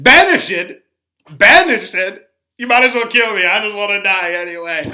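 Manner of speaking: loud, high anguished cry of a banished lover; loud, high despairing Romeo. Retold or imitated. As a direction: wail it like a heartbroken teenager. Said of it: "Romeo acting very much to the news".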